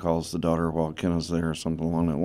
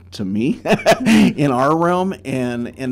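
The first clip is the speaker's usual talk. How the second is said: laughing; 6 semitones higher, 8 dB louder, slower than usual. Real, not acted. Laughs mid phrase.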